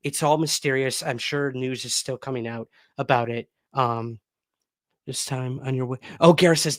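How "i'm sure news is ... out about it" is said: ordinary delivery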